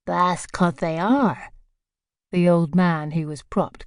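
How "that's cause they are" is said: bone-dry, slow and deadpan; slow, dry local voice, words held back; drier still. Acted. Village postmistress to a stranger, slow and bone-dry. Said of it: "her voice drier still".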